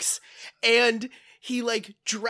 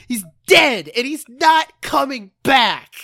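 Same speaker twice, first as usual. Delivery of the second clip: much louder, forceful declaration; emphatic insistence; 2 semitones higher, 10 dB louder than usual. Real, not acted. Telling co-host to accept Mace's death, loud and blunt. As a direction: hammer each phrase home loudly.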